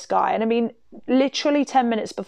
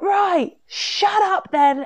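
high-pitched, drawn-out telling-off; imitated scolding; 11 semitones higher, much slower than usual. Friend tells him off from the landing, scolding.

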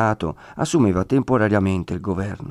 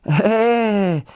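high, long drawn-out "Eh"; signifying great distance; 12 semitones higher than usual. A narrator's reading. Friar showing how distant Rimini is, long high "Eh". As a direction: stretch it high, as if pointing far off. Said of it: "per significare una gran distanza".

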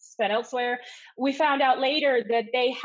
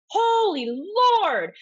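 high, long drawn-out exclamation; dramatic retold dismay; retold shock; 8 semitones higher, 4 dB louder, much slower than usual. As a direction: stretch it out in stunned disbelief. Retold shock on seeing the film.

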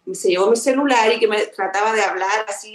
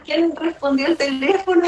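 retold puzzlement; 7 semitones higher than usual. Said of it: "Me quedó siempre esa duda".

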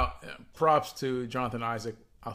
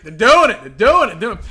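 higher, much louder mock cheering; mock hype; 6 semitones higher, 12 dB louder than usual. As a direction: parody a friend's yearly hype. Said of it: "And how the Magic".

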